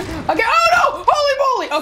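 high shout, laughing; shocked laughing shout; startled shout. Real, not acted. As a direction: yelp it out in shock. Ambushed during the match.

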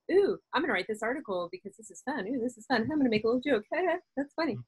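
high, light and amused, with laughs; playful giggly voice. Pretend. Writer voicing fun work mode, light and laughing. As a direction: delight in your own little joke.

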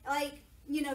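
normal talking voice

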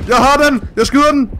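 high, loud battle cry; real heat of the fight; excited shout. Real, not acted. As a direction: shout it while firing. Shooting shark in game.

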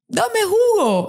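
put-on high, slow, tearful-sounding child's demand; little girl. Snotty daughter after crying finally asks for juice, high and slow. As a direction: draw it out high like a worn-out child.

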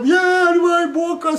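hammed-up child's bawling plea; tearful begging. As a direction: wail loudly for what you want. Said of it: "plângând să ne iei niște bocanși".

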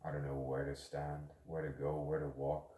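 low, hushed, hesitant musing; quiet, understated unease; mildly anxious. Acted. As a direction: think aloud softly, a touch anxious. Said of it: "Anxious me".

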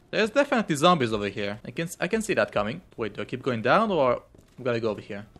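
fast nervous chatter; rushed. Real, not acted. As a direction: rattle it off quickly. Rushing through dangerous area.

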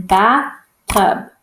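"bathtub" split into two separate beats; chunked syllables. Shown as a demonstration. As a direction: leave a clear gap between bath and tub in "bathtub". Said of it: "Our words are chunked in".

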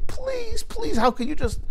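high, wailing, broken plea; sobbing plea. Retold or imitated. Desperate father begging the Rebbe for help, sobbing. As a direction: beg the Rebbe through sobs. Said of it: "breaks down completely, sobbing".

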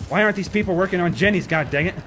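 frantic. Real, not acted. Frantic in game.

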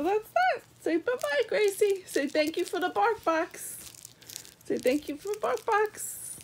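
high puppy-talk voice; high, cooing sign-off to a pet; coaxing pet talk. Real, not acted. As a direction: coax the dog to say thanks. Coaxing dog to say goodbye.

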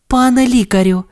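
high, polite nurse. Acted. Nurse addresses the doctor, high and polite. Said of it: "звернулася до Сирника медсестричка".

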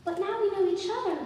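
put-on high, loud child voice. Child insisting to a reluctant stranger, childlike. Child figure answers narrator.

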